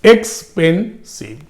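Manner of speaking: Expensive broken into এক্স-পেন-সিভ; three measured beats on expensive with short gaps; syllable by syllable. Shown as a demonstration. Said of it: "more than two syllable হয়েছে".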